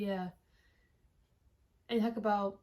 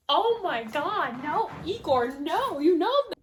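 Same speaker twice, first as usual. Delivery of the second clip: exasperated protest; 5 semitones higher, 7 dB louder, slower than usual. Retold or imitated. Indignant outburst at the scarf.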